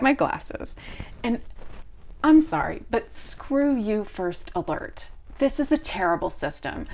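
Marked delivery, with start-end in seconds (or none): none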